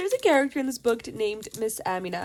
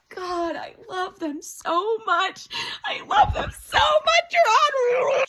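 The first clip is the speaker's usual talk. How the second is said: gushing fangirl wail; 10 semitones higher, 5 dB louder than usual. Real, not acted. Reader adoring the bickering couple, building fangirl wail. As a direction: gush it, climbing into a loud squeal.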